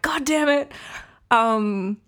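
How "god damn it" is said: higher, exasperated mock outburst; frustrated curse. Admitting her partner was right, higher exasperated curse. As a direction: throw up your hands, caught out. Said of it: "And I'm like".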